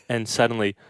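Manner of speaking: ordinary talk, nothing marked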